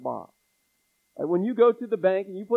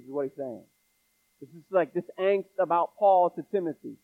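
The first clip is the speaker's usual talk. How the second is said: ordinary talk, nothing marked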